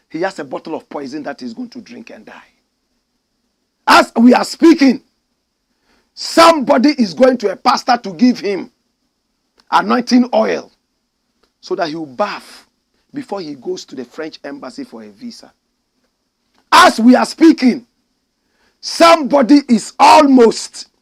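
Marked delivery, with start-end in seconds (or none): 3.9-10.7 preaching shout. Real, not acted. Preaching crescendo to congregation. 16.7-20.9 preaching shout. Real, not acted. Preaching crescendo to congregation.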